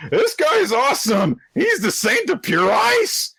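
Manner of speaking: high, loud hype shout; over-the-top squealing hype-man; excited fanboy. Retold or imitated. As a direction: gush about him in a high-pitched yell. Voicing excited hype-man character.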